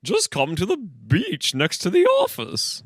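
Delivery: high, slow put-on cop voice; officer character voice. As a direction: call the chief in a silly high voice. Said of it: "I'm using a lot of my voices today".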